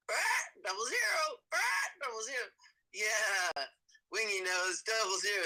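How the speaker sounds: high, squawky parrot voice; Wingy's parrot voice. Pretend. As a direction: squawk it like a talking bird. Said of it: "the paired voice that I'm doing".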